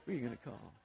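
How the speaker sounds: murmured way down, almost under the breath; hushed movie catchphrase. Shown as a demonstration. Pastor teasing the congregation with a hushed catchphrase. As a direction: let the famous line slip out softly. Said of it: "I know you're all thinking right now".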